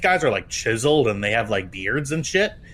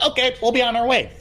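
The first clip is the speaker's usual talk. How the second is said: breezy cop, higher and fast; casual cop; 12 semitones higher, 4 dB louder, faster than usual. Retold or imitated. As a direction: toss it off like a cop who's done already. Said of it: "the cops will be like".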